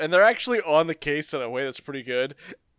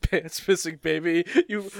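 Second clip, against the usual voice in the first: ordinary talk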